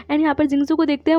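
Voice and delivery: ordinary delivery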